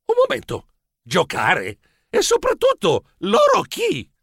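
startled questions leaping high in pitch; taken aback. Acted. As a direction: interrupt in puzzled surprise. Protagonista sorpreso interrompe il cameriere. (domande stupite e acute)

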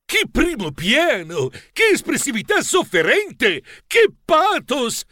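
high, drawn-out theatrical raptures; exclaiming film director. A narrator's reading. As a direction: gush over the shot, stretching each phrase. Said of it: "mi inquadrò il muso con le dita ed esclamò". (regista enfatico, esclamazioni allungate)

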